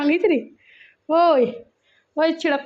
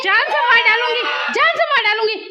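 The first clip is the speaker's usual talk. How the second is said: high, loud play-threatening yell; hammed-up fury; mock threat; 7 semitones higher, 4 dB louder than usual. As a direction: threaten them like an over-the-top protective mother. Mock threats defending one child.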